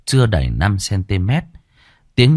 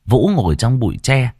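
ordinary delivery

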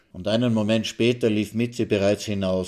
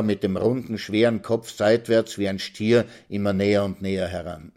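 ordinary delivery, nothing marked

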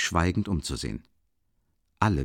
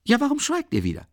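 very high, quick complaint, falling at the end; agitated outburst; 7 semitones higher, 5 dB louder than usual. A narrator's reading. Complaining that the company is silent again, agitated outburst. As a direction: burst out, then run down in pitch.

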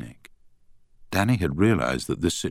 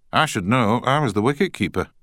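put-on Scottish accent; 7 semitones higher than usual. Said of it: "in a strong Scottish accent".

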